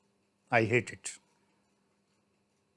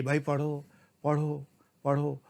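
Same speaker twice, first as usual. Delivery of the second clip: softer, slow pleading coax; mock coaxing; 7 dB quieter, slower than usual. Teacher voicing the government begging students to study, soft slow coax. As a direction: coax a lazy kid, softly and slowly.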